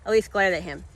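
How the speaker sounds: plain reading voice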